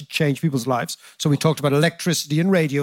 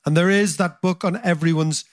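ordinary talk

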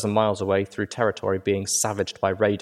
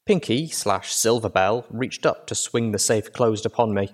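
ordinary delivery, nothing marked

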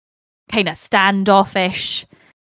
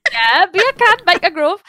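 put-on a rough, higher, louder stab at a Newcastle accent; attempted Newcastle accent; 9 semitones higher, 5 dB louder than usual. Friend asks for a Newcastle accent, a hammed-up try. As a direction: have a go at a Geordie Newcastle accent and ham it up. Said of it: "That's the extent of my Newcastle accent".

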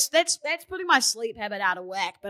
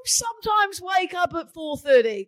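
indignant shout; 7 semitones higher than usual. Real, not acted. Defending herself, raised voice.

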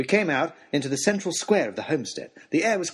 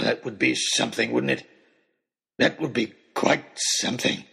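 mad hunter, menacing. A narrator's reading. Oxshott pictures Nicholas as a trophy, gloating. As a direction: savour the threat like a madman.

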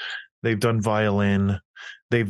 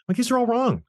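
higher, incredulous at every wrong answer; exasperated disbelief; 10 semitones higher than usual. Retold or imitated. Dad finding the math all wrong, incredulous. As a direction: throw up your hands at the homework.

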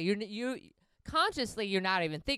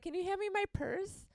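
higher, prim grandma ask; 12 semitones higher than usual. Pretend. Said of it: "I'll just be like".

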